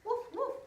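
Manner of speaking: high, loud cheerful woof; playful dog bark. Acted. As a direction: bark like a happy puppy for the child. Dog sound in picture book.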